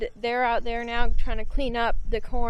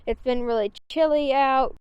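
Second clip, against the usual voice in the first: normal talking voice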